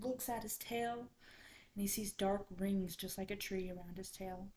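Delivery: plain reading voice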